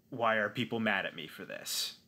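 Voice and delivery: ordinary talk, nothing marked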